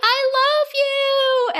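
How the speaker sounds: long, drawn-out high shout into the night; hammed-up lovesick yell, stretched out slow; mock shouted love cry. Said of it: "He's shouting into the night".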